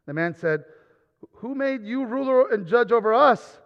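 reading the passage aloud, building up; scripture reading. Acted. Preacher reads Exodus to the congregation, rising to a call.

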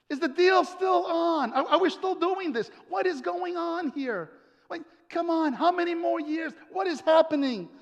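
high-pitched, exasperated questioning; baffled disciples. Acted. Disciples demanding to know if the promise stands, exasperated. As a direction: pile up the bewildered questions. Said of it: "the disciples are like".

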